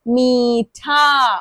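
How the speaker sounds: मीठा with ee held very long; stretched long ee. Shown as a demonstration. Teacher demonstrates long ई in a word, drawn out. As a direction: drag out मीठा slowly. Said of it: "long sound demo".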